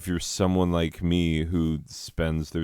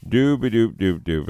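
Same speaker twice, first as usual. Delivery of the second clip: a higher, louder doop-a-doop sing-song; mock typing noise; 6 semitones higher, 6 dB louder than usual. The brain pretending to look something up, higher louder sing-song doops. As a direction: hum a fake busy typing sound. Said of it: "your brain is like".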